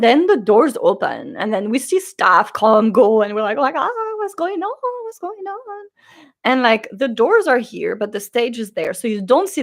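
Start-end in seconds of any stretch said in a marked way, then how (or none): 3.8-5.9 tiny, high mock-panicked squeal; flustered. Fans watching staff come and go, flustered. Acting crowd as doors open.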